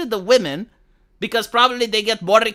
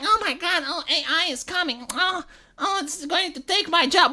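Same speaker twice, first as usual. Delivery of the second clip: high, soft mock whine; imitating a helpless little whiner; mock whining; 6 semitones higher, 5 dB quieter than usual. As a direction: whimper in mock panic, pitched up and hushed. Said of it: "bitching and whining about A I".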